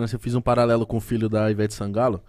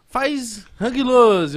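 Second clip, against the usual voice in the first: high, slow, coaxing mother; mocking proud mom; 9 semitones higher, 5 dB louder, much slower than usual. Proud mom showing off her baby to friends, high coaxing. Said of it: "A mãe dele falava".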